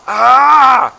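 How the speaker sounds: loud, high, long "ah"; drawn-out scream of exasperation; frustrated scream. Retold or imitated. Skier reliving a frustrating run, loud frustrated scream. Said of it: "It was so frustrating".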